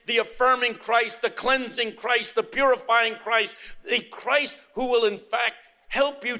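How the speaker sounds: high, emphatic gospel proclamation; preaching at full force. Real, not acted. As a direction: declare it forcefully to the congregation.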